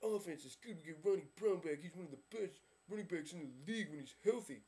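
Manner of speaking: mock fan gushing about his team; mock Dolphins fan. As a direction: brag like a smug Miami fan online. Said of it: "you're like".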